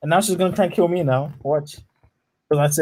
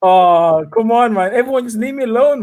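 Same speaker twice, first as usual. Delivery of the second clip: exasperated shout; 6 semitones higher, 5 dB louder than usual. Real, not acted. Chased again.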